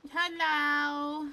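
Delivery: loud call. Real, not acted. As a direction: call out to someone. Calling out in game.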